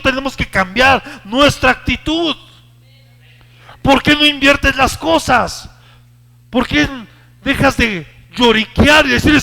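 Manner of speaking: high, loud fervent preaching; impassioned preaching. Real, not acted. Preacher pleading loudly with the congregation. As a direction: press the point hard, full voice.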